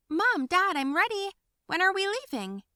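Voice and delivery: high, loud, bursting with excitement; birthday girl calling her parents; eager call. Acted. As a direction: call out like it's your big day.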